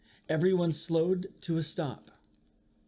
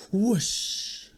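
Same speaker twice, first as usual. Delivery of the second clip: whoosh; 5 semitones higher than usual. Acted. Reading a picture book aloud.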